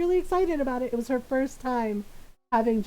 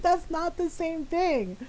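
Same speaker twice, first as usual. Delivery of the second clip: slow, higher, emphatic mock protest; exasperated; 4 semitones higher, slower than usual. Grown child correcting mom, slow mock exasperation. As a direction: spell it out in comic frustration.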